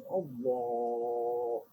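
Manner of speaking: drawn-out Allah as in the takbir; prayer call. Shown as a demonstration. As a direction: intone it like opening a prayer. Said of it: "dia sebut takbiratul ihram".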